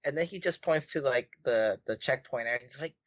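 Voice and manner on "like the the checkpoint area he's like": ordinary delivery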